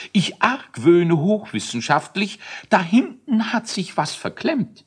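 squeaky know-it-all scientist; high nerdy scientist voice. Acted. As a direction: pitch up, fussy and learned. Scientist Elisius explains.

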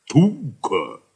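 put-on pleased croak of an old stove robot. A narrator's reading. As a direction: croak it out like a rusty machine. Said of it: "krächzte der Roboter erfreut".

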